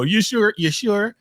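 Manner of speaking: a touch of put-on doubt; Elder Mother. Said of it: "Like, Elder Mother".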